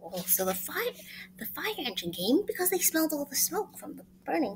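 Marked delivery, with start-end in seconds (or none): none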